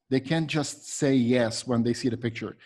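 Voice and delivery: ordinary delivery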